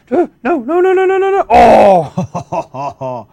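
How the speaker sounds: high loud shouting; frantic cries of no; man down shout. Real, not acted. Kid about to die.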